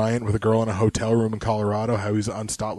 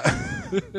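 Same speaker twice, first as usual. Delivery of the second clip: high laugh; 6 dB quieter than usual. Real, not acted. After singing.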